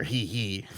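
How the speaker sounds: soft villain giggle; quiet sinister hee hee. Acted. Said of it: "What's with the hee hee?".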